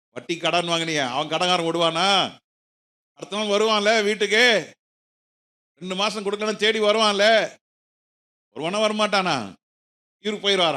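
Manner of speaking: loud, high rhetorical questions; forceful challenge. Acted. Preacher challenges a borrower about the creditor coming home, forceful challenge. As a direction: press the congregation hard with each question.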